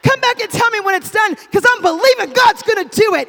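loud, high, ringing declarations; full-force preaching. Real, not acted. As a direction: proclaim it to the whole church, hitting each promise hard.

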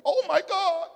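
high, laughing mock panic; laughing imitation of a panicked wife. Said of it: "what if she would have panicked".

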